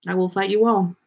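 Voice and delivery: ordinary talk, nothing marked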